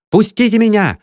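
high-pitched demand to be let in; raging shout. A narrator's reading. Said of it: "бушевал кто-то за дверью". (яростный крик высоким голосом)

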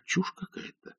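plain reading voice

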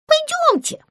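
squeaky little call; high puppet call. Acted. Puppet calls her friends, squeaky. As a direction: call the others along.

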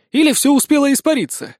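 high-pitched character offering a guess; speculative suggestion. A narrator's reading. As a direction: toss out a theory in a high voice. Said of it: "предположил Сисюля". (предположение высоким голосом)

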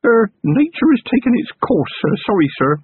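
sheepish lad owning up; Feeney apologizing to his commander, embarrassed and high; damp little voice. A narrator's reading. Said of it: "Feeney said in a damp little voice".

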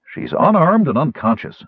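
high-pitched, insistent rebuttal. A narrator's reading. Knight defending the unconscious captive, insistent. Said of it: "Sturm insisted".

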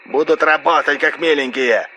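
high, insistent phone voice; angry roar. A narrator's reading. RS insists men will work, angry phone roar. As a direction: overrule him forcefully through the line. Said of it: "взревел Р С".

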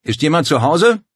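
laut und hoch gerufene Frage; rufend. A narrator's reading. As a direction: nach jemandem im Haus rufen. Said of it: "Paul rief Lorenzos Namen und fragte". (laut rufend)

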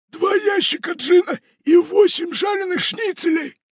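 very high, shouted greedy excitement; excited pirate. Acted. Pirate raves to Funtik about treasure, very high and shouting.

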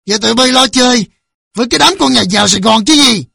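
loud, high, scornful questioning; angry father's accusing question; cold accusation. A narrator's reading. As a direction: berate them with biting contempt. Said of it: "Ông Thiện lạnh lùng hỏi".

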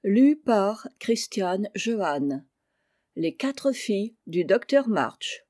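usual speaking voice

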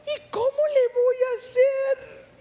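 very high, slow, drawn-out wail; effeminate little voice. Retold or imitated. Preacher imitating the man told to change voice, high effeminate whine. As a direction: imitate him asking helplessly in a high little voice. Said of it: "también vas a cambiar tu voz".

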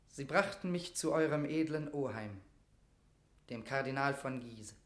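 ordinary delivery

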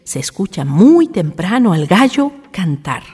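usual speaking voice

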